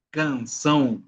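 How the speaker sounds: "Canção" ending in a nasal "ão"; nasal vowel. Shown as a demonstration. Teacher giving an example of the tilde, nasal ending on "Canção". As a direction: let the end of "Canção" glide through the nose. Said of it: "con un sonido nasal".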